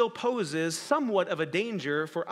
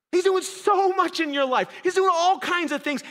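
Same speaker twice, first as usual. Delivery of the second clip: higher, louder preaching build; higher, louder emphatic sermon declaration; full-force preaching; 11 semitones higher, 5 dB louder than usual. Acted. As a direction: proclaim it with conviction.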